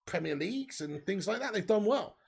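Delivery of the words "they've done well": ordinary talk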